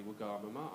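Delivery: supposed correct Wagamama, said smoothly; mock-correct pronunciation. Shown as a demonstration. Advising his mate before a date, mock-correct Wagamama. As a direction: Wagamama as one flowing foreign-style word. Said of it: "pronounce it the correct way".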